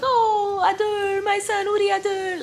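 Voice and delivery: a mother crying out in delight at her son; overjoyed mom. Retold or imitated. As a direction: mom tearfully greeting her son at the door. Mom greets her son joyfully.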